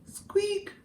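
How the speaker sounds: tiny soft squeak, very high; high soft mouse squeak. Acted.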